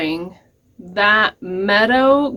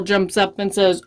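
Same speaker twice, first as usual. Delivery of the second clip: usual speaking voice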